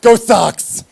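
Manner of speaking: put-on loud, high drunken Bostonian yelling; drunk Boston fan. Comedian mimicking drunk Boston voters, boisterous high yell. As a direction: holler it like a hammed-up drunk Sox fan. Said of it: "they were so drunk they just kept running".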